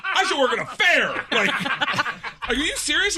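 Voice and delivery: thrilled at the memory; laughing excitement. Real, not acted.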